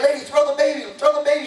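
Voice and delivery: high yelling from the crowd. Retold or imitated. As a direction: shout it up to the top floor. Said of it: "they were yelling".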